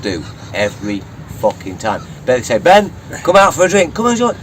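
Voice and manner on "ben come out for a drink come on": high, loud mock pleading; mimicked pestering friends. Friends coaxing Ben out for drinks, mock pestering. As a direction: badger him in a raised, imitating voice.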